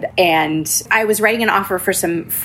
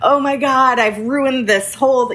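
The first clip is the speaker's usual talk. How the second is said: mock panic, higher and slow; panicked inner voice; 7 semitones higher than usual. Realtor reliving her mistake, mock panic. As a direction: replay the moment of dread. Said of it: "I had this wash of terrified shame".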